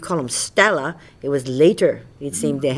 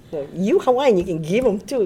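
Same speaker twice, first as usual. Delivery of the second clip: Hawaiian pidgin. Shown as a demonstration. Teasing a fellow Hawaiian, pidgin accent. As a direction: tease in local Hawaiian pidgin. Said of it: "That's how we talk all the time".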